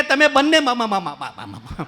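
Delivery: stuck on મ-, dropping low; mock stammer. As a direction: stammer through મ- repeats. Principal stammers too.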